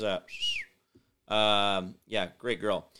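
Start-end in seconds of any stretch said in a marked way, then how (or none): none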